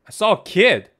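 blurting out what you just saw; startled excitement. Real, not acted. Streamer startled after seeing a ghost kid, loud and high.

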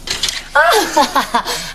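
teasing burst of laughter; amused laugh. Acted. Mother laughing at her bashful son, amused. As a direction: laugh at the child's shyness.

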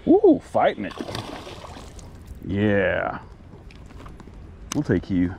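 ordinary talk, nothing marked

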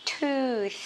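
tooth said with the tongue between the teeth on the th. Shown as a demonstration. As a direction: model tooth with a clear th. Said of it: "mình đưa lưỡi ra".

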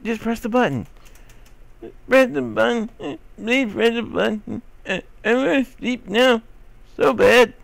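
high, pleading voice begging to sleep; whiny sleepy character. Acted. Gamer voicing the sleepy character, high and whiny. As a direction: whine like a kid who needs bed.

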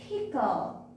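bare puffed p, then the whole word "pickle"; isolated p sound. Shown as a demonstration. Teacher showing children the first sound, isolated p. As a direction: sound out the p before saying "pickle". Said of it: "all of these has p sound".

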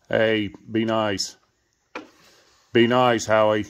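loud, firm warning to a pet; firm scolding. Real, not acted. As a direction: order a pet to behave, loudly. Scolding Howie.

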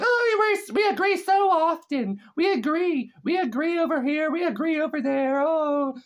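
high, sing-song mock agreement; mocking politicians. Vlogger mocking State of the Union politicians, high sarcastic voice. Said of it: "They're acting like".

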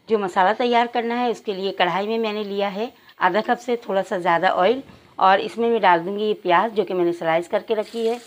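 ordinary talk

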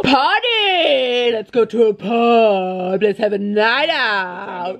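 drawn-out mock party holler; hammed-up party cry. Calling friends out to the pub, hammed-up party cry. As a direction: stretch it out slowly like a rallying call.

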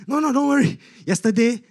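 high, quick mock reassurance; lightly acted brushing away of worry; reassuring patient. Patient calming the nurse, high-pitched reassurance.

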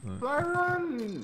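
incredulous slow echo of supply run; dismissive question. Real, not acted. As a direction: scoff at the idea. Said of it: "What about the supply run?".

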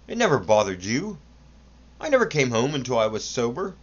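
lightly voiced complaining boy; aggrieved son. A narrator's reading. Son complains to his father, mildly aggrieved. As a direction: complain of being begrudged. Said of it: "he sighed".

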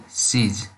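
"Sees" as one syllable; "Sees", a single falling word; contracted "she is". Shown as a demonstration. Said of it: "we say".

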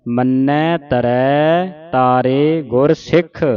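slow, measured model recitation; scripture recitation demo. Shown as a demonstration. Teacher showing congregation correct Japji Sahib reading, slow recitation. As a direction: recite it slowly for learners to copy. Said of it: "ਇਸ ਤਰ੍ਹਾਂ ਪੜ੍ਹਨਾ ਹੈ".

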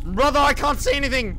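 loud, high panicked yell; panicked shout. Real, not acted. Panicking in the dark.

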